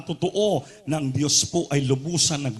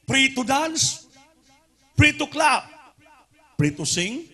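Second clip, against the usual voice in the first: fiery preaching; 9 semitones higher than usual. Acted. Preacher rousing the church to shout in freedom, fiery. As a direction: whip up the congregation, calling it out high.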